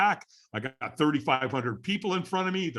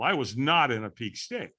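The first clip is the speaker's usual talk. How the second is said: ordinary talk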